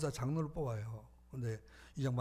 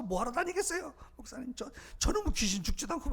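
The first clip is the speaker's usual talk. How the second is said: wife's mock nagging; 9 semitones higher than usual. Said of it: "부인이 다 일러서".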